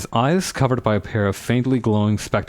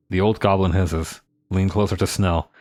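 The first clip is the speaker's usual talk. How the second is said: usual speaking voice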